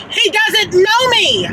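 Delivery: high, slow, emphatic denial; drawn-out, high-pitched indignant insistence; indignant protest. Retold or imitated. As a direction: stretch it out high in exasperation. Protesting that caller is a stranger.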